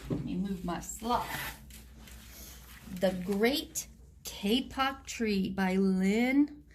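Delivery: ordinary delivery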